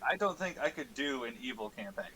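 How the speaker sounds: ordinary delivery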